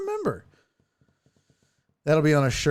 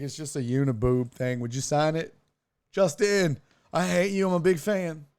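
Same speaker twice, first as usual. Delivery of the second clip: ordinary talk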